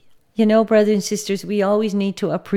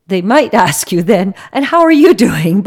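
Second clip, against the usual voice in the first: laughing; 9 dB louder than usual. Real, not acted. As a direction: talk through a real laugh. Amused aside, laughs while speaking.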